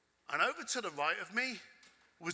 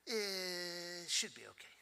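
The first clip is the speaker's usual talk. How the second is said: put-on Italian accent; 9 dB quieter, slower than usual. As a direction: shrug it off in a mumbled Italian accent. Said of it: "I apologize about my Italian accent".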